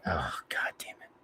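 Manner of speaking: whispered curse under the breath; dreading whisper. Retold or imitated. GM dreading the owner's call, whispered curse. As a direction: hiss it out as the phone rings, dreading it.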